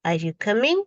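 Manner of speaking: "coming?" lifts up at the very end; rising question tune. Shown as a demonstration. Teacher repeating the yes-no question, rising at the end. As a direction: let the pitch climb on "coming" as you ask. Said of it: "your intonation will rise at the end".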